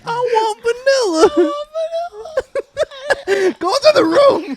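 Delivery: put-on high, slow whiny kid voice; crying child voice. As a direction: whimper like a spoiled kid.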